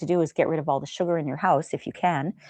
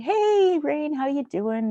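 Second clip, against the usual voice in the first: a light, much higher, drawn-out "Hey, Rain"; chummy coworker greeting; 9 semitones higher, much slower than usual. Retold or imitated. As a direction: imitate the pesky boss dropping by, sing-song. Voicing the hovering CFO.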